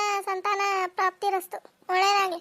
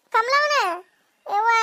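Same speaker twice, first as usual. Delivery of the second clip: raised, loud questioning call; surprised recognition; 5 dB louder than usual. Acted. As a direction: call out as if spotting an old acquaintance. Spots an acquaintance, asks if it's Kamala.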